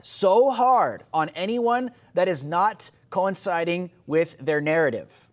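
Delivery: usual speaking voice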